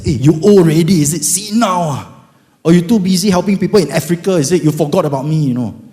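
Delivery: loud, high exasperated scolding; hammed-up rebuke of God. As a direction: tell God off at full volume. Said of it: "I even scolded him".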